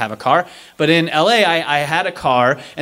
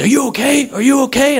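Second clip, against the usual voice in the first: urgent doctor; 9 semitones higher than usual. Retold or imitated. Doctor voiced, urgent high.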